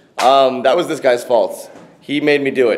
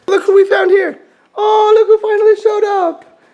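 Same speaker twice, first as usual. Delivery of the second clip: mock high greeting; 4 dB louder than usual. Greeting late colleague teasingly.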